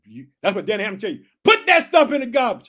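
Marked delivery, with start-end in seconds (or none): 1.4-2.7 shout. Real, not acted. Preacher shouts command.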